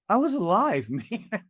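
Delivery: high-pitched retold shock, breaking into a laugh; amused disbelief. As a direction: exclaim it in mock astonishment. Retells sudden startled realisation of age.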